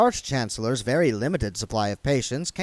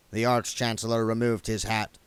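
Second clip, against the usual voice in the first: plain reading voice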